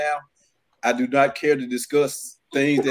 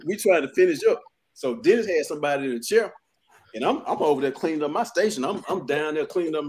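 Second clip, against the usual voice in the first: ordinary talk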